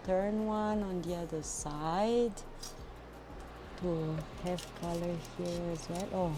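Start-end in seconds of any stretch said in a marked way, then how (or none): none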